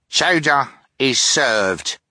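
cheerful announcement. A narrator's reading. Phil announcing the chowder to the table, cheerful and slow. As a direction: announce dinner like a proud cook. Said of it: "announced Phil".

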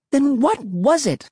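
put-on very high, demanding girl. A narrator's reading. Emily challenging her brother, high-pitched demand. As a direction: demand an answer in a shrill pitch. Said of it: "Emily demanded".

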